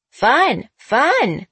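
high, loud parrot squawk. A narrator's reading. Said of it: "echoed Roger".

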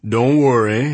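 loud, easy reassurance; calm the guest down; reassuring. A narrator's reading. Adams reassures.

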